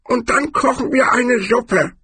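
very high barbarian voice, crestfallen; high-pitched, woebegone character voice; Cohen. A narrator's reading. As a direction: finish the suggestion miserably, high and squeaky. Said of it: "wie ein Häufchen Elend".

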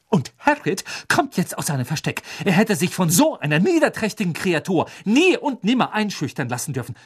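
high, emphatic indignation; angry determination. A narrator's reading. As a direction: declare it hotly, hammering key words. Hermine furiously vows to fetch Hagrid.

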